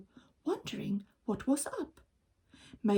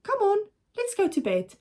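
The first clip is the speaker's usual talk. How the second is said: higher, louder hurried husband; Mister Gregg; 10 semitones higher, 4 dB louder, faster than usual. Acted. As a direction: brush it off a little too high. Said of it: "All he said was".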